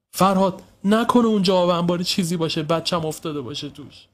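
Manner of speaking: high worried plea that sinks at the end; fearful mother. Acted. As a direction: voice your dread, fading on the last words. Mother fears child has fallen in.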